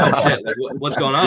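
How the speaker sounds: a woman caught off guard, suddenly scared; mock scared. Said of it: "she got scared for a second".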